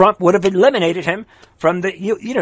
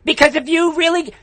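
indignant, high-pitched outburst; really riled and insistent; worked up; 9 semitones higher than usual. Real, not acted. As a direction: rant with your pitch pushed up. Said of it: "I don't wanna get worked up".

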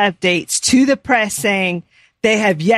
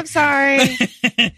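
slow, higher, sing-song sorry; drawn-out mock apology; 4 semitones higher, much slower than usual. Real, not acted. To his co-host after the Feinstein joke, a drawn-out mock apology. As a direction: stretch out a sheepish fake apology.

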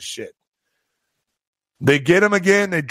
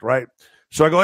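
ordinary talk, nothing marked

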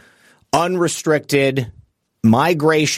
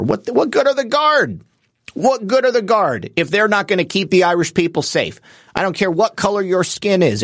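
indignant outburst; 6 semitones higher than usual. Real, not acted. Outraged rhetorical question about police.

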